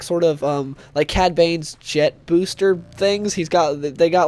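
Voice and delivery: ordinary talk, nothing marked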